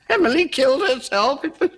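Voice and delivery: ordinary talk, nothing marked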